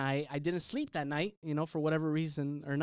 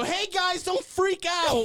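higher, slow mock-calming call; reassuring; 12 semitones higher, 4 dB louder, much slower than usual. Hungover stowaway calming the family, slow and reassuring. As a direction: ease them in gently but slowly. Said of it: "And I go".

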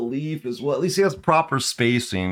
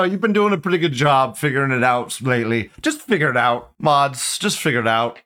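ordinary delivery

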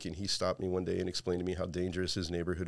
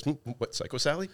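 mock confused; 7 semitones higher than usual. Mike's reply acted out.

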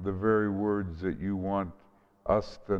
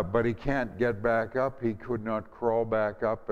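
ordinary talk, nothing marked

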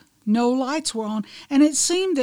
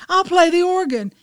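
high, loud volunteer's call; chiming in; 8 semitones higher, 7 dB louder than usual. A narrator's reading. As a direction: chime in loudly to offer help. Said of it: "Another chimed".

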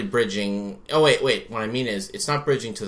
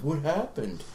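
higher, lightly acted daze; mock bewildered; 4 semitones higher, 4 dB quieter than usual. Possessed guy gets up after the exorcism, mock bewildered. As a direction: ask it as if just coming round.

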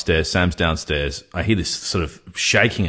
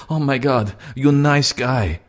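imitated Russian woman; 8 semitones higher than usual. Retelling his date's verdict on him, imitated dismayed Russian woman. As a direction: mimic her pitched well up.

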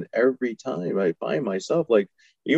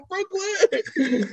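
high, laughing repeat of "Brooklyn". Real, not acted. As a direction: crack up while echoing the word. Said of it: "It's like Brooklyn for Houston".

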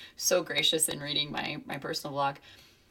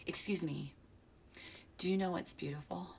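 whisper; 8 dB quieter than usual. Retold or imitated. Child's line retold, whispered.

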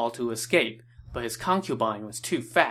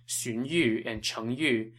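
ordinary delivery

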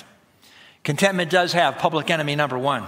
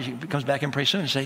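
ordinary talk, nothing marked

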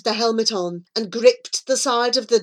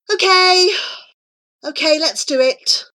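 high, hesitant agreement; reluctant, pitched-up okay; deeply uncertain; 8 semitones higher, 5 dB louder than usual. A narrator's reading. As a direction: give in after a nervous pause. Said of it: "said Charlie, deeply uncertain".

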